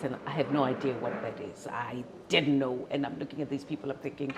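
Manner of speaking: ordinary talk, nothing marked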